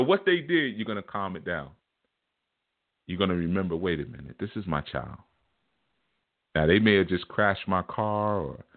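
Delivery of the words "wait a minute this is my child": dropping low and soft, calming down; a parent's low, soft thought while looking into a crying child's eyes; softened realization. Retold or imitated. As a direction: hush yourself as you remember it's your child.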